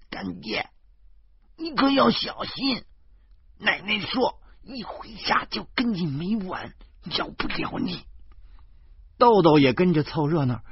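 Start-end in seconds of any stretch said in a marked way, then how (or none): 0.1-8.0 put-on high little girl warning, dropping to a hush; a child worried for her godfather; 紧张的小女孩; suddenly nervous. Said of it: "突然紧张地说".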